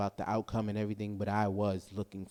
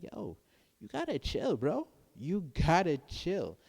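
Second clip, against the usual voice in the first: imitating Coach B; 8 semitones higher than usual. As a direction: play the chill friend telling him to relax, pitched way up. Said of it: "he was just like".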